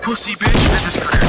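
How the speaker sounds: loud, high trash talk; hype yelling. Real, not acted. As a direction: yell it at the enemy. Hyping kill in game.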